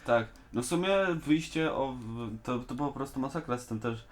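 ordinary talk, nothing marked

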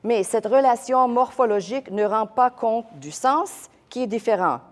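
French text read with French vowels and uvular r. Shown as a demonstration. Teacher reading the French scholar to the class. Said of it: "I'm gonna read the French".

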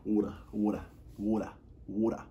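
badly faked Australian accent; butchered accent. Shown as a demonstration. Practising friends' accents and failing, badly faked Australian accent. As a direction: have a clumsy go at an Australian "water". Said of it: "I'm butchering every single accent".

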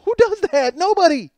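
high-pitched comic yelling; outraged disbelief. Retold or imitated. Husband berating his wife over the nickname, outraged. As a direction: holler it in exasperated astonishment.

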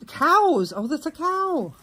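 high, excited squeal of discovery; delighted surprise. Real, not acted. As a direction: voice leaps up with delight. Spots cows on the gifted dress.

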